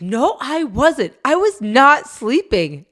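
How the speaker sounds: put-on slow, high childish protest; high, drawn-out kid denial; retold kid yelling. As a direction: insist like an upset kid. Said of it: "Drews is yelling at one of the kids".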